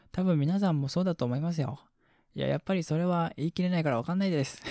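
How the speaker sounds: lifted, lively fan-message read; コメント読み上げ. Acted. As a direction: read out a listener's chatty message with energy. (明るめの声でお便りを読む)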